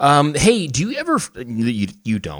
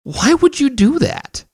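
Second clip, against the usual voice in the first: high, slow disbelief; incredulous; 3 dB louder, slower than usual. Real, not acted. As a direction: stretch it out in disbelief. Incredulous reaction to quote.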